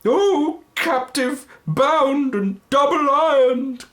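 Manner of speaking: very high, loud, slow anguished wail; the phantom crying out to Scrooge in anguish; phantom's cry. Acted. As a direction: cry out like a tormented spirit. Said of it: "cried the phantom".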